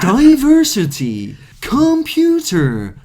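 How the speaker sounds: high, slow, exaggerated CD narrator; mock textbook voice. Mocking the classroom CD, high and slow. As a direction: stretch each word like a bad recording.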